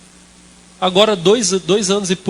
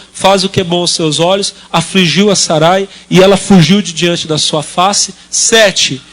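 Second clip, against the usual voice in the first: scripture reading; 5 dB louder, slower than usual. Acted. Reads scripture aloud.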